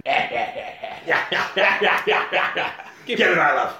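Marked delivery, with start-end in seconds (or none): 3.1-3.8 laughing mock order.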